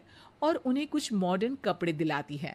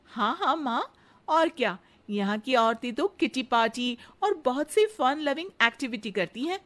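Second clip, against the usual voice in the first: put-on higher, eager young bride's voice; sweet daughter-in-law; 4 semitones higher than usual. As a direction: flatter the mother-in-law sweetly. Radha answers.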